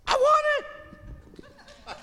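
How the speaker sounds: put-on very high, slow squeaky child voice; silly high-pitched kid voice; pre-puberty voice. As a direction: squeak it out like a little boy. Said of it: "Say it in your pre-puberty voice".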